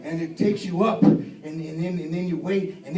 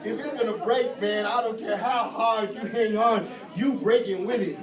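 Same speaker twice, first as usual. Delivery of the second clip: normal talking voice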